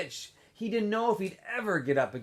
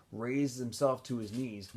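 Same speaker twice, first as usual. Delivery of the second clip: ordinary delivery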